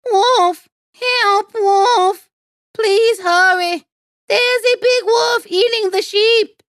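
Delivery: very high, loud frantic yelling; top-of-the-voice shout. A narrator's reading. Boy faking a wolf attack for the villagers, shouting. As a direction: scream for help to a distant village. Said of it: "at the top of his voice".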